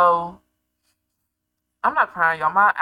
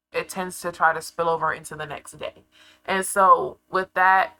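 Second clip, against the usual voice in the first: usual speaking voice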